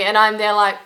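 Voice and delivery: usual speaking voice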